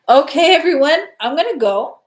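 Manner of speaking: cheerful loud call to everyone. Real, not acted. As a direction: call out to the whole chat. Calling out to sign off.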